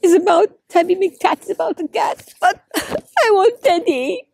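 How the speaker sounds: high, loud hammed-up whimpering; mock crying. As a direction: pretend to be upset for the children. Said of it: "I'm crying, I'm sorry".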